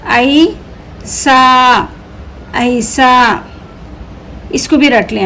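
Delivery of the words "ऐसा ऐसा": very slow, syllable by syllable; slow drawn-out reading. Shown as a demonstration. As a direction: stretch ऐसा out slowly for learners. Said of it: "teacher stretches the word for pupils".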